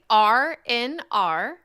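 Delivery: "R N R" drawn out slowly; slow, separated. Shown as a demonstration. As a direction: say "R N R" letter by letter. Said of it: "but say it fast".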